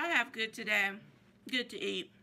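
muffled words between bites; chewing food mid-sentence; talking while chewing. Real, not acted. Seen 0: lips pressed and cheeks bulging between words, food at hand.

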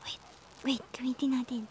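unvoiced hushed whisper. Real, not acted. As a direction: whisper so the enemy won't hear. Hushed while hiding.

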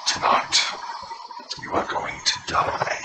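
booming echoing villain monologue; a gloating undead lord welcoming doomed guests; Lich Lord voice. Acted. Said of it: "The Lich Lord?".

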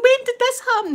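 high-pitched, alarmed question; mock distraught. Housemate questioning the widow about the death, mock distraught. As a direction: play the shocked housemate, voice shooting up.

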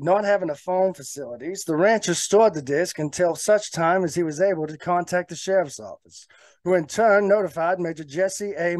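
put-on old-time newsreel announcer reading a bulletin; vintage news anchor voice, carried in one long breath; 1940s news anchor. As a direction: read it like a forties radio newsman. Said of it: "in my best nineteen forties, uh, news anchor voice".

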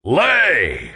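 long, swooping command; storybook giant's imperious cry. A narrator's reading. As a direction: order the hen with a drawn-out call. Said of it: "said the giant again".

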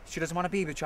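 ordinary talk

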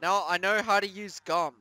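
ordinary delivery

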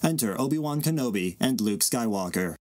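ordinary talk

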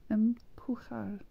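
"Empujar" whispered, slow and unvoiced; whispered repeat. Shown as a demonstration. Learner whispering "Empujar" to herself to memorise it. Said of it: "tomar means to take".

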